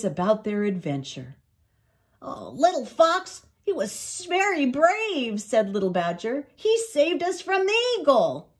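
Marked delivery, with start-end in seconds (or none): 2.2-5.4 high, proud praise of a friend; high, wowed young animal; admiring young badger. A narrator's reading. 6.6-8.5 high-pitched proud report; high, thrilled little voice; admiring young badger. A narrator's reading. Badger praises friend to family.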